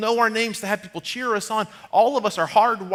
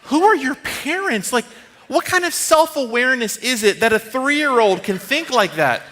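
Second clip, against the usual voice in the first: amazed disbelief; 6 semitones higher, 6 dB louder than usual. Retold or imitated. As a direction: marvel out loud at the kid. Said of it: "And I was like".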